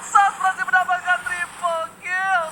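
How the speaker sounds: fast, high, loud teamfight call; hype casting. Real, not acted. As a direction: scream the kills out live.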